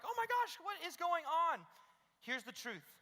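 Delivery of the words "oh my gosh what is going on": mock panic.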